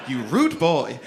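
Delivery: a hint of posh voice; posh West London. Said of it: "that is like".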